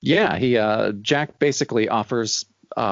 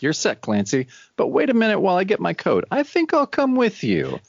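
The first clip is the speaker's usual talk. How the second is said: Philip's eager offer; 7 semitones higher than usual. Retold or imitated. Philip voiced in retelling.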